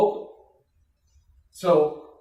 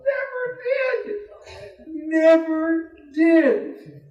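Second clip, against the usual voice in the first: high, drawn-out never did, much higher; emphatic never; 12 semitones higher, 6 dB louder, much slower than usual. Real, not acted. DJ marvelling that the woman never broke, high and slow. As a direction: stretch it high in disbelief. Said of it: "laughing at the prank".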